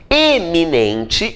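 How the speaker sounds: "Eminente" drawn out with a clear opening E; very slow "Eminente", holding the first E; marked initial E. Shown as a demonstration. Teacher singles out this paronym, stretching the initial E. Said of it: "este aqui".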